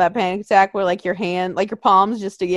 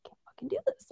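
panicked squeak; 12 semitones higher, 17 dB quieter, much faster than usual. Retold or imitated. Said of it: "clawing at the side of my mask".

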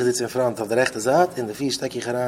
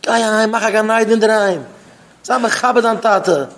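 high, raised lecturing voice; impassioned rebuke; 6 semitones higher, 4 dB louder than usual. Acted. Speaker lectures on respect for father, raised rebuke. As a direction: press each point high and forcefully.

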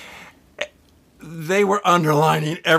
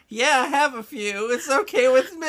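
doctor okaying drinks; 10 semitones higher than usual. Pretend. Co-host mocks a doctor allowing a teenager drinks, lightly put-on high voice. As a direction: play an absurdly permissive doctor, high-voiced. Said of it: "the doctor's saying".

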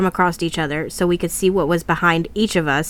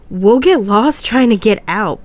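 higher, louder, slow nervous protest; nervous laugh; 4 semitones higher, 5 dB louder than usual. Acted. James argues against walking out at night, nervous. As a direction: stretch it out, uneasy and insistent. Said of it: "James laughed nervously".

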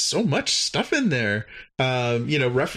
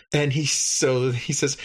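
ordinary talk

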